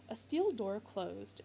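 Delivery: plain reading voice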